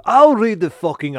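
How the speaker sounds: loud, high angry snap; angry outburst. Acted. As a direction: bite back, loud and high. Chef snaps back at host.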